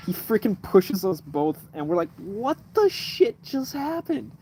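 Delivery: usual speaking voice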